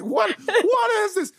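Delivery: high, slow, laughing disbelief; retelling old movie husband, high laughing disbelief. Retold or imitated. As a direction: squeak it out through laughter. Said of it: "it's like".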